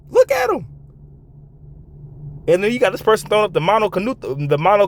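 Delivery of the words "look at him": incredulous. Real, not acted. Repeats phrase much higher.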